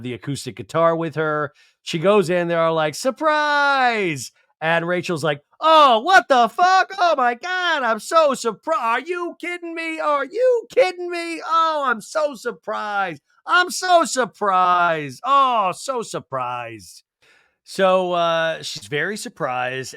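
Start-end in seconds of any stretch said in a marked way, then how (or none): 2.9-4.3 mock group surprise. Group shouting at party. 5.6-17.0 high, hammed-up gasping shock, slowing and fading at the end; fake shock. Rachel greeting Jen's surprise party with hammed-up, fading shock.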